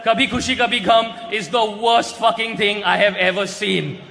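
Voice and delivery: ordinary talk, nothing marked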